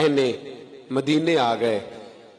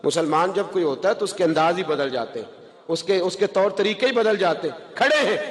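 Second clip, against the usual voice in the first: ordinary delivery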